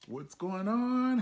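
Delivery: high, stretched-out slow hello; sing-song high greeting. Shown as a demonstration. Greeting viewers in a slow, high sing-song.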